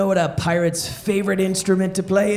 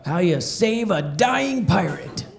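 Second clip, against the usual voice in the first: normal talking voice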